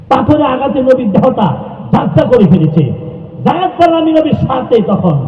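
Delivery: high-pitched sermon narration; pitched well up, preacher's lament; impassioned preaching. Acted. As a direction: cry out the story from the pulpit. Preaches the stoning story.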